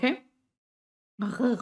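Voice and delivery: a lone R gargled in the throat; throaty German R. Shown as a demonstration. Teacher isolates the German R sound, gargled. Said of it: "Y es diferente a la R".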